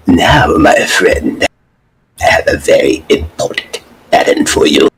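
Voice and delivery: menacing evil emperor whisper; Palpatine voice. Acted. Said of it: "that Palpatine voice is downright fucking diabolical".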